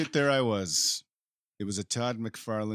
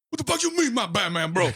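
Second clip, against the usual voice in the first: loud, high, frantic outburst; freaked-out yell; 10 semitones higher, 6 dB louder than usual. Retold or imitated. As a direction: yell it in total panic. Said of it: "I freaked the fuck out".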